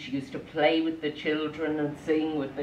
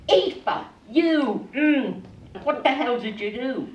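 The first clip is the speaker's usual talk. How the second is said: fuming; 8 semitones higher than usual. Acted. Father confronts his wife over the swans, fuming. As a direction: round on her in hammed-up fury. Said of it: "he was fuming".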